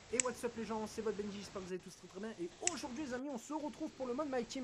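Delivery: high, upbeat hype-host greeting; streamer intro voice. Retold or imitated. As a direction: pitch it up like opening a live stream to fans. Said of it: "Moi, je suis en live".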